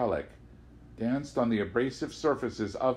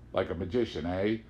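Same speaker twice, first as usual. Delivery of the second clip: ordinary delivery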